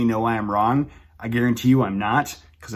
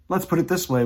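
ordinary delivery

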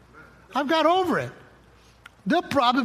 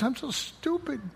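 imitating a tearful child, soft and higher; mock-sobbing child, softly upset; crying little kid; 5 semitones higher, 5 dB quieter than usual. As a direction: play a small upset kid confiding in Dad. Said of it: "she's crying one day as a little kid".

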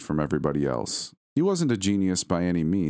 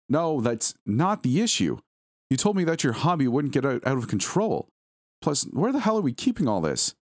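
angry; 8 semitones higher than usual. Acted. Wife scolding husband over purchase.

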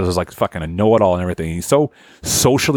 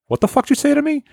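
much higher, outraged squawk; hostile stranger; faster than usual. Retold or imitated. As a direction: play the touchy stranger who takes offence. Stranger snaps back at greeting.

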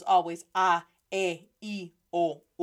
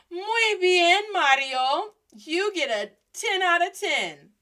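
high, loud teacher praise; hammed-up approval; mock praise; 7 semitones higher, 4 dB louder, slower than usual. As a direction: congratulate the student with exaggerated delight. Teacher mock-praising a student's bad pronunciation.

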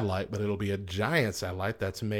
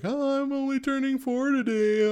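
smug mock cheer. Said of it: "where you're like".

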